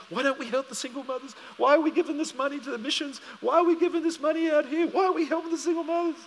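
high fast indignant questions; heated complaint. Retold or imitated. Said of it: "She was on about the single mothers".

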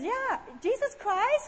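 high, slow Italian-accented attempt; voiced Italian husband's broken English. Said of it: "I sounded like Chinese then".

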